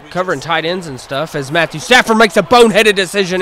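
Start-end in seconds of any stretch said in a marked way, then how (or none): 1.5-3.4 excited recap. Real, not acted. Recapping the interception.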